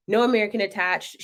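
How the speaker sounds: ordinary talk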